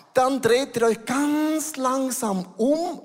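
"ganz langsam" drawn out high and slow; stress on langsam. Shown as a demonstration. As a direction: stretch "langsam" high and unhurried. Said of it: "Langsam ist die Betonung". (Betonung auf „langsam“, hoch und gedehnt gesprochen)

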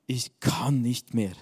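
slow, weary groan. A narrator's reading. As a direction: moan it out, worn down. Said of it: "stöhnte er".

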